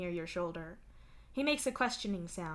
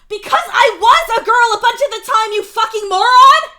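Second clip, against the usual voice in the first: high, full-force angry scream; furious shout; 24 dB louder than usual. A narrator's reading. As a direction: yell it like you've lost all patience. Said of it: "he shouts".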